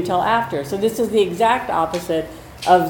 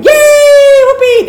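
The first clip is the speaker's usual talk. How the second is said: much higher and louder, a whooping celebration; excited cheer; 15 dB louder, much slower than usual. Shown as a demonstration. Trainer ending a session with a big cheer, excited shout. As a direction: shout it like a party at the end of training. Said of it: "by going".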